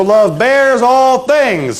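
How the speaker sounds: slow, high, every word stretched; slow recitation. Shown as a demonstration. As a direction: lead the chant slowly. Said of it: "Say it with me".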